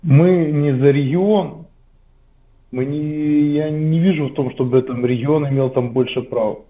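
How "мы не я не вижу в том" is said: ordinary talk, nothing marked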